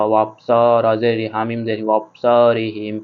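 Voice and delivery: ordinary delivery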